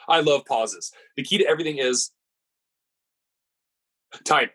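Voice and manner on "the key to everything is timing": "The key to everything is", dead air, then "timing"; long comedic pause. Shown as a demonstration. Podcaster joking about loving pauses, with a long pause before "timing". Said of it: "I didn't just cut out there".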